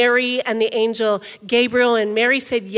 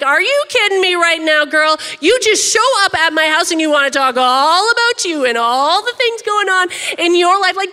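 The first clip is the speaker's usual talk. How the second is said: higher, louder, fast sassy indignation; mock outrage at a cousin stealing the spotlight; indignant; 5 semitones higher, 5 dB louder than usual. Elizabeth indignant that Mary only talks about herself, sassy. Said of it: "Elizabeth could have felt a little bit, uh, indignant".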